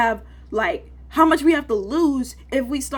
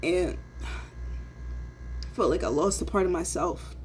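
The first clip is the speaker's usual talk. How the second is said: quiet and slow, voice thinning with sadness; choked up; 4 semitones lower, 6 dB quieter, slower than usual. Real, not acted. Young trader admitting what the injury cost him, quiet and choked. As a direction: let the loss catch in your throat. Grieving loss of sport.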